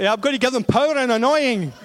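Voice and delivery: laughing through a mock announcement; high and loud, cracking up at the typo. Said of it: "I was thinking".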